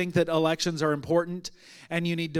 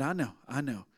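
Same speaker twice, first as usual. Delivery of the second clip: low, soft and tender; calm someone down quietly; hushed, understanding retelling; soothing reassurance; 6 semitones lower, 8 dB quieter than usual. Retold or imitated. Retold soothing answer to upset woman.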